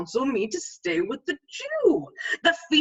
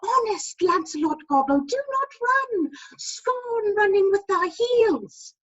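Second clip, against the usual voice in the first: much higher pleading conscience voice; 12 semitones higher than usual. Retold or imitated. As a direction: warn him in a piping little voice. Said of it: "Or, as aforesaid".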